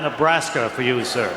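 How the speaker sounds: normal talking voice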